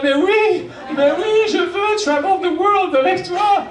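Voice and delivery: high-pitched excited yes-yes; high eager voice. Pretend. His own eager reply, mocked.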